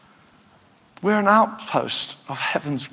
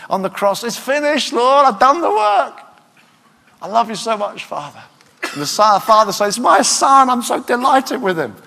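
fervent prayer; 8 semitones higher, 4 dB louder than usual. Acted. Preacher voices Jesus to Father.

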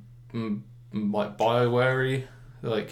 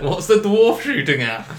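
higher, louder, laughing mock alarm; mock panicked shout; 8 semitones higher, 9 dB louder, slower than usual. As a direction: yell for the missing healer in jest. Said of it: "I don't wanna be like".

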